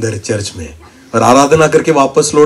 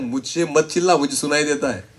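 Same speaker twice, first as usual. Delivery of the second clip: softer, calm answer from God; calm reply; 12 dB quieter than usual. Retold or imitated. God answering the shouting believer, soft and calm. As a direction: settle him down gently.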